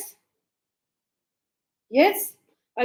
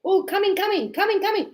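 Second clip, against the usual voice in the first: mock boss calling; 7 semitones higher, faster than usual. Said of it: "my boss said".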